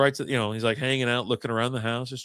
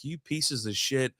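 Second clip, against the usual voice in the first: slow, softer, drawn-out insult; disgusted; 7 dB quieter, slower than usual. Retold or imitated. Finder at the same house and car, disgusted. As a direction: draw it out in disgust. Fed up with owners ignoring door.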